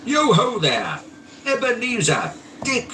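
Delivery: high, ringing call; jovial calling out. A narrator's reading. Fezziwig calling his apprentices, rich jovial shout. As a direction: call your apprentices like a jolly old master. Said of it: "called out in a comfortably oily, rich, fat, jovial voice".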